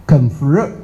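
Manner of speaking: ordinary delivery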